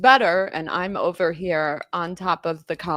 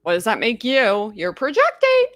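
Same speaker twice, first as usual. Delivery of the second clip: mocking retort; 3 semitones higher, 3 dB louder than usual. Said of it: "It's like".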